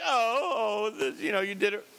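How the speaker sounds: hammed-up cooing sympathy to a puppy. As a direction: coo feebly instead of correcting. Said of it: "and you're like".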